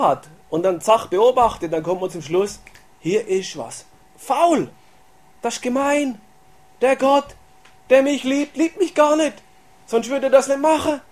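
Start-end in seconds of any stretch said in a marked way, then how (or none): 3.0-11.0 hurt complaint. Retold or imitated. Distrustful believer accusing God, high hurt protest. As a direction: accuse God of not loving you.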